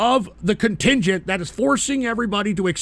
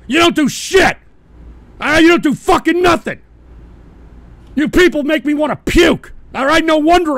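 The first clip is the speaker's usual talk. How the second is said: loud, higher yelling; furious shout; 5 semitones higher, 5 dB louder than usual. Real, not acted. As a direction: scream at them in disgust. Berating viewers in chat.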